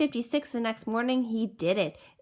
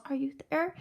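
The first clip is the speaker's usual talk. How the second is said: higher, softer questioning call; searching call; 4 semitones higher, 4 dB quieter than usual. A narrator's reading. Horton calls into each clover, questioning softly. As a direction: call down hopefully to someone tiny. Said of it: "searched them and called".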